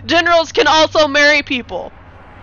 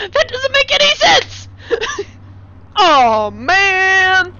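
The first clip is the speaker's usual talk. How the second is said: laughing exclaim; 6 semitones higher, much slower than usual. Real, not acted. Breaking up laughing.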